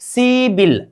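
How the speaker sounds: "सिबिल" as a long see, then bil; correct pronunciation. Shown as a demonstration. Teacher models the changed sound for students, "सिबिल" pronounced with b. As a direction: say "सिबिल" with b in place of p. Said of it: "उच्चारण कीजिए".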